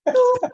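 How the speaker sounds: high, loud spoken beep; imitated voicemail beep. Shown as a demonstration. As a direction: mimic an answering machine beep.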